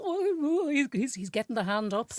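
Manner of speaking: put-on high squeal of a gawking teen; a giddy teen onlooker spotting the snog; gossiping onlooker. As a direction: mimic the kids watching, squeaky and thrilled. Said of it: "looking as well".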